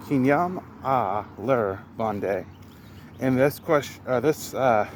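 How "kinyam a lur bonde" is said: "Kinyam a lur bonde" slow, each word spaced out; slow careful pronunciation. Shown as a demonstration. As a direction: say it slowly for the learner. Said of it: "Next sentence here".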